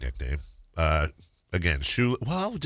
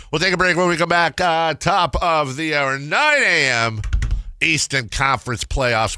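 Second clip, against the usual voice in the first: radio host read; 6 semitones higher, 5 dB louder than usual. Acted. Radio break announcement.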